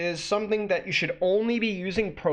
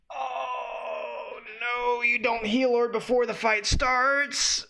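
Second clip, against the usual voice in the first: high, drawn-out whine near mock crying; despairing wail; 7 semitones higher, much slower than usual. Real, not acted. Lamenting an orb used before fights start, despairing wail. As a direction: moan it slowly up high.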